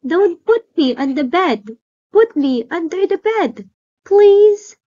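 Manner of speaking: a little monster pleading; tiny little voice. Acted. Baby monster begging Jeff, tiny pleading voice. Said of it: "He said in a tiny little voice".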